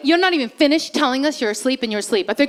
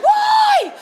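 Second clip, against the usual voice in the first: normal talking voice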